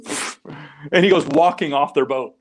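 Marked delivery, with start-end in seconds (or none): 0.0-0.6 unvoiced, quiet dismissive puff of air; dismissive pfft. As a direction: blow it off like a shrugging kid. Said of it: "he just shrugged his shoulders and goes".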